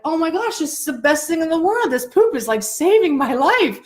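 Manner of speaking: high-pitched, overjoyed bird in a funny story; mock elation. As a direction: gush with relief, hammed up. Voices revived bird's joy in story.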